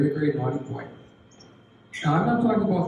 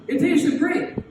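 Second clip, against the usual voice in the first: put-on kid voice; 11 semitones higher, much slower than usual. Boy answers teacher about cookie.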